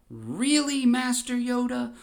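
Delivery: jumps way up in pitch; startled high squeak. Retold or imitated. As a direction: caught out, voice shoots up. Palpatine perturbed.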